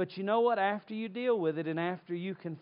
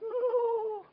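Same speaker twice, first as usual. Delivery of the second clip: much higher frightened ooh; scared whimper. Retold or imitated. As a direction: squeak it like a terrified kid. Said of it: "I was like".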